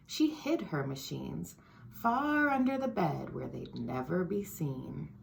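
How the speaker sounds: put-on lively storybook read-aloud for kids; reading to children. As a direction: read it like story time for little ones. Reads picture book to children.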